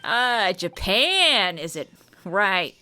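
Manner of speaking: broad New York mobster talk; New York accent. Acted. Old mobster sizing up foreigners, New York accent. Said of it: "slip into a New York accent".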